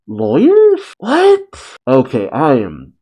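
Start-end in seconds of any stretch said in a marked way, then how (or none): 0.1-1.8 real astonishment, voice shooting high; baffled surprise. Real, not acted. Reader reacting to the manga twist, stunned and high. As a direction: pitch jumps way up in disbelief.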